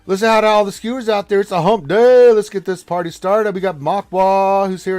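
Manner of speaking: loud and high, pumping up the crowd; hype host. Real, not acted. Host welcoming viewers on hump day, loud hype. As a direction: kick the party off at full volume.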